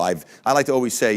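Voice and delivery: usual speaking voice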